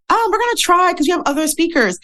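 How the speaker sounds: high, put-on voice for the organizer girl; the nervous speaker retelling how the event girl stalled her, in a high mock voice; imitating the event girl. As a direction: mimic the hesitant organizer, pitched well up. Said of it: "And she's like".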